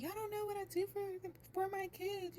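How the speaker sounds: imitating tearful storming off. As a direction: retell her exit in a high, upset pitch. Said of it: "she was like".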